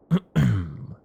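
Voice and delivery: pointed throat-clear; composing "ahem". Acted. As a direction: clear your throat to get serious. Composing self for serious interview.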